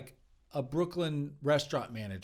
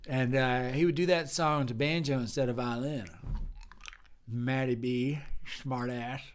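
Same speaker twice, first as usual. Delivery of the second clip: loud, drawling sugar daddy who calls everyone hun; sleazy sugar daddy; 6 dB louder than usual. Acted. Improv sleazy banjo-loving sugar daddy charming the bistro staff. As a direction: swagger in like you own the bistro, hun.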